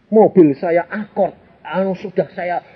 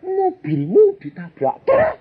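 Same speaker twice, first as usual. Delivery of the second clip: wife's cry retold, much higher and slow; mock yell; slower than usual. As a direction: call out like a startled wife, slowly. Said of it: "Istri saya teriak". (meniru teriakan istri, tinggi dan lambat)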